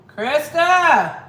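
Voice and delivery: loud high call across the house; yelling for Krista. Real, not acted. Mom yells for her daughter Krista, loud and high. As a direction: shout her name to another room. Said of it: "she can hear me yelling".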